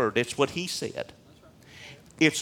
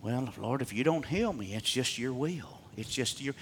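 much softer mock-resigned quote; resigned prayer imitation; 2 semitones lower, 8 dB quieter than usual. Preacher mimicking believers who doubt healing, soft resignation. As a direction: hand it over to God quietly. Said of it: "wanna revert to".

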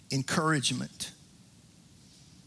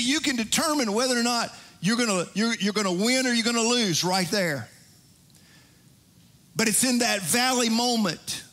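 raised, sung-along preaching over organ music; 5 semitones higher than usual. Acted. Pastor preaching to the congregation over music, fervent. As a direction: preach it out with rising fervor.

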